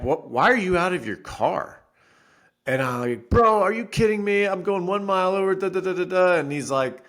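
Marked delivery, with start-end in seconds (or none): 0.0-1.8 calm cop. Retold or imitated. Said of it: "He just calmly is like". 3.3-6.3 high, loud exasperated protest; retold heated outburst; indignant protest. Retold arguing with cop.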